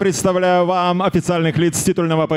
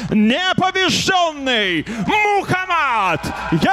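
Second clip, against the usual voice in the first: higher and higher, ending in a shouted name; fighting game announcer; 4 semitones higher than usual. Real, not acted. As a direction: build the hype to a big finish. Ring announcer introducing the champion.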